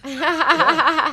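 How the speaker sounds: loud high laughter; laughing. Real, not acted. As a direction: burst out laughing. Laughing at jumpsuit joke.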